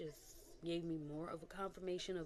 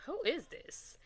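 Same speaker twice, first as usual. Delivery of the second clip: puzzled; 11 semitones higher, 5 dB louder, slower than usual. Retold or imitated. Puzzled by an unknown commenter.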